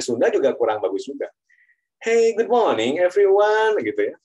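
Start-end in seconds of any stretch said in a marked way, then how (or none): 2.0-3.8 high, slow, hammed-up British English; put-on British accent. Mock posh British greeting. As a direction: lecturer mocking a student's fake British accent. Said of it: "Tapi logatnya logat British".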